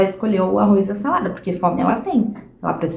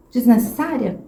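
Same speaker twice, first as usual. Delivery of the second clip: ordinary talk, nothing marked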